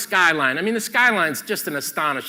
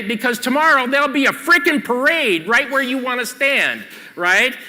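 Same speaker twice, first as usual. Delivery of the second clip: raised, higher, emphatic lecturing; exasperated warning; 7 semitones higher, 4 dB louder than usual. Real, not acted. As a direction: hammer the warning home to the audience.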